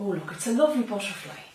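ordinary delivery

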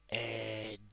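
ordinary delivery

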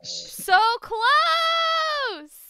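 high, very loud drawn-out cry; agonised shout. Real, not acted. Player just misses the hole, agonised shout. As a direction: wail the near miss at full volume.

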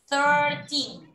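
Thirteen said clearly for the class to echo; model for repeating. Shown as a demonstration. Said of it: "Repite después de mí".

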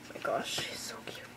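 breathy low whisper of adoration; swooning whisper. Real, not acted. Fan whispering in adoration over a cute photo. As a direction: whisper it, melting.

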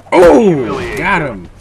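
loud, drawn-out whoop; excited outburst. Real, not acted. Player reacting to a kill, loud excited whoop.

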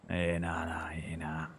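breathy whispered yeah nah; whispered mock Aussie. To the viewers, joking about Australians, a whispered mock Aussie yeah nah. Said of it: "mock Aussie yeah-nah, whispered".

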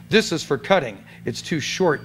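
ordinary delivery

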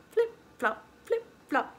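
words popped out like slapping sandals; flip-flop sound effect. Shown as a demonstration. Storyteller showing what sound flip-flops make, imitative. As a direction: imitate shoes slapping along. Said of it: "What sound do they make?".

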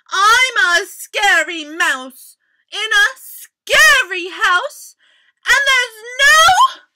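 loud, high brave mouse shout; biggest, bravest voice. A narrator's reading. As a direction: puff yourself up and call out boldly. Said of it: "called in his biggest, bravest voice".

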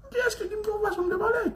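high, wailing cry on the whole question; mock wail. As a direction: whine it out like a hurt child. Same retort wailed.

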